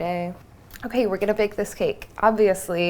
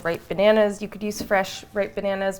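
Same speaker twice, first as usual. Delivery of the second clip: ordinary talk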